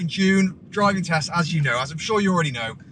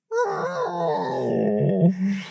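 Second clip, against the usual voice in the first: disbelieving laugh; 10 semitones higher than usual. Real, not acted.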